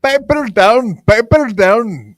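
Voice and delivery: high, loud, slow mock Scottish; attempted Scottish accent. Host repeating his catchphrase for co-host, bad Scottish accent. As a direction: stretch it out in a badly faked Scottish accent. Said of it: "No, that's my Scottish accent".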